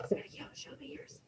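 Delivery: real soft whisper. Real, not acted. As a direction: whisper it privately to one student. Teacher whispers aside to student.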